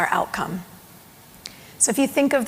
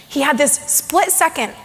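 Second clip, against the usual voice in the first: normal talking voice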